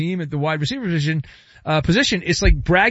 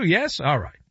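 normal talking voice

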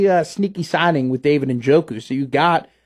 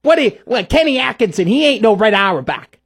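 higher and louder, a blustery sports-radio rant; Mad Dog impression; 5 semitones higher, 3 dB louder than usual. Radio co-host imitating Mad Dog for his partner, blustery and high. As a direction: ham up the barking radio host voice. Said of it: "That's my best, uh, Mad Dog".

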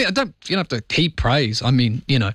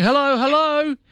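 high, far calling voice; hammed-up high neighbour calling out; calling hello; 12 semitones higher than usual. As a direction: sing out hello searching the street. Dog owner calling out in street.

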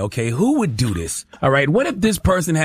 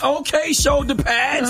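high, drawn-out teasing nickname; casual nickname callout; 11 semitones higher, slower than usual. Acted. Showing how friends label each other, high teasing callout. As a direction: call out a nickname, pitched way up. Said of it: "this is how we talk".

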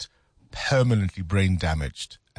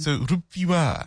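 "Ruphiwa" slow and high, mock French accent; 6 semitones higher than usual. Host Frenchifies co-host's name, mock French accent. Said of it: "love the French accent".

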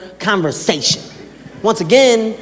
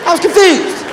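baffled; 8 semitones higher than usual. Retold or imitated. Comic bafflement at uncle's orders.

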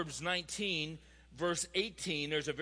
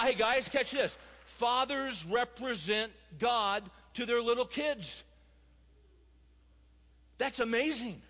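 raised, emphatic sermon voice; preacher pressing hard on fathers; passionate preaching; 6 semitones higher than usual. Acted. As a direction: drive the point home to the congregation. Preaching at full force.

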